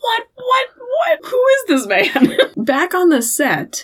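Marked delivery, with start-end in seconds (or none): 0.0-2.4 high-pitched squeal of disbelief; incredulous. Real, not acted. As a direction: squeak in comic bafflement. Incredulous reaction to the character.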